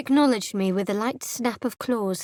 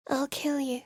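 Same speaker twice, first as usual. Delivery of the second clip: ordinary talk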